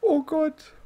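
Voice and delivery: startled. Real, not acted. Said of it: "ich bin jetzt gerade erschrocken".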